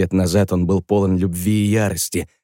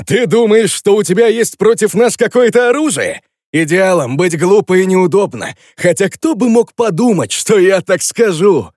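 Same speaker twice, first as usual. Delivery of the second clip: high, loud mocking villain voice; escalating comedy rant; 9 semitones higher, 5 dB louder than usual. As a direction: sneer it out, rising and gloating. Zak's mocking voice after laughing.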